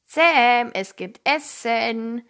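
loud call on the name, then slow; big brother calling his brother down to dinner; calling from downstairs. A narrator's reading. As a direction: call up the stairs, stretching the rest. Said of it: "rief sein Bruder Cody von unten".

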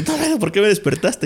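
high-pitched retold complaint; mock protest. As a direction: play protesting at being woken, pitched well up. Acts protest at being woken. (imitando una queja, con voz aguda)

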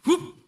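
high, loud startled "uf" gasp; mock frightened yelp. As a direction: blurt a sharp fright sound. Mother startled by the voice.

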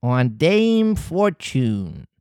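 very slow sinister echo; slow cat echo; creepy cat echo. Acted. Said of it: "repeated the cat".

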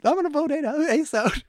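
mock crying, very high-pitched; hammed-up tearful wail in a high put-on voice; Sugar. Co-host imitating a crying contestant at the vote, mock sobbing. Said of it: "crying, being like".

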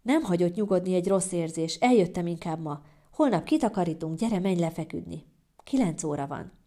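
ordinary delivery, nothing marked

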